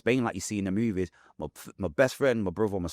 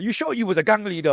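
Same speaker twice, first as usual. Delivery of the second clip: high, loud mocking question; teasing coworker; 11 semitones higher, 5 dB louder than usual. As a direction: tease him like a doubtful coworker.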